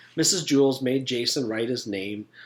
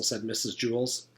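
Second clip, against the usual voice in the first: usual speaking voice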